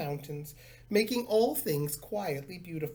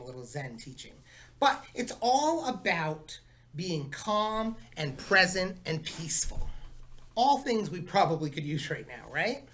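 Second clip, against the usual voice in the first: plain reading voice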